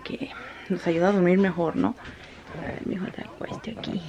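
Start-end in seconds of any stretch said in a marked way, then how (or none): 2.6-4.0 whisper. Real, not acted. Mother whispering as she lays her son down. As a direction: whisper him down gently.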